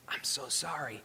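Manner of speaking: soft, low, mildly tearful retelling; hushed retold apology. Storyteller quotes a sailor starting to weep, hushed apology. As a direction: voice the old sailor's apology very quietly. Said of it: "he just begins to wail".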